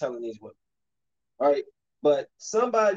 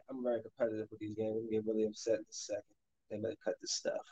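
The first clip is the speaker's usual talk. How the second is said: much softer and fast, under the breath; muttered aside; 5 semitones lower, 12 dB quieter, faster than usual. Real, not acted. Gamer apologizing to viewers for his temper, quick muttered aside. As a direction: mumble it quickly to yourself.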